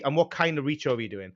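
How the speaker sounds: ordinary talk, nothing marked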